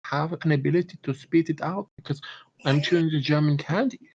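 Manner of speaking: put-on high-pitched, harmless German accent. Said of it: "your inconsequential German person voice".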